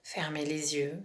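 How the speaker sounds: calm relaxation guide, half whispered; guided meditation. Acted.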